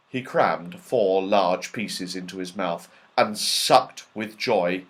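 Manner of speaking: plain reading voice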